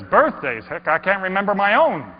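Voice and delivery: loud jokey reply; amused quip. Acted. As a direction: laugh it off warmly. Man jokes about himself.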